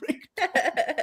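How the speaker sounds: "Rick Dalton" said high and slow through laughter; laughing. Real, not acted. Repeats quote while laughing.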